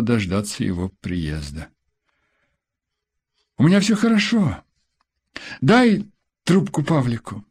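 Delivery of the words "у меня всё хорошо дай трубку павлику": hurried reassurance. A narrator's reading. Wife answering husband quickly.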